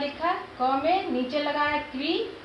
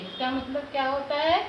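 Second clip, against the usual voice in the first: usual speaking voice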